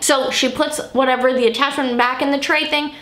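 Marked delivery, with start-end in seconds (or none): none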